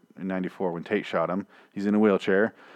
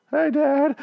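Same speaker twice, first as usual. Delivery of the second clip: put-on much higher, sweet, apologetic girl; a high, timid girl saying hi to her dad; teen daughter voice. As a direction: play the meek daughter at a high pitch. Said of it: "and she's like".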